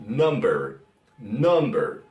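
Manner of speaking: "number" with a low, dull English uh vowel. Shown as a demonstration. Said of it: "como en la palabra, por ejemplo".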